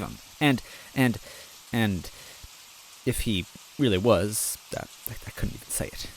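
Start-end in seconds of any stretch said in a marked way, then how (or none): 4.8-6.1 whispered fast, barely getting it out; hushed, rushing over a thought too painful to say; breathless whisper. Acted. Luigi whispering the fear he can't voice, fast.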